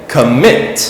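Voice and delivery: "commit" with MIT stressed and rising; stress on second syllable. Shown as a demonstration. Teacher showing learners where the stress falls, second-syllable stress on "commit". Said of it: "The stress is on the second part".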